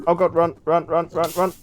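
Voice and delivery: high, urgent running panic; being chased. Real, not acted. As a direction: urge yourself to flee. Fleeing mobs in Nether.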